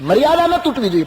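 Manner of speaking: ન hit high and hard, preacher's punch; emphatic maxim. Real, not acted. Preacher repeats key maxim. (ન પર ભાર મૂકીને ઊંચા સાદે)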